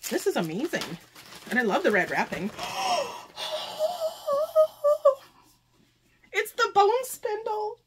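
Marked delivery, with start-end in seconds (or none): 2.5-5.2 high, drawn-out gasp of joy; real overwhelmed gasp; long delighted gasp. Real, not acted. Unwraps a longed-for book. 6.3-7.8 high, slow, overwhelmed squeak; high-pitched, choked-up delight; trying not to cry. Real, not acted. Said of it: "I'm trying not to cry".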